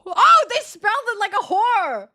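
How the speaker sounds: high, loud indignant exclaim; loud shocked cry; shocked outburst. Real, not acted. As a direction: blurt it out in disbelief.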